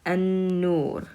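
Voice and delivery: An-Nur with a held, doubled n. Shown as a demonstration. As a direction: lean on the nn in An-Nur. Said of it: "pronounce it with the shadda".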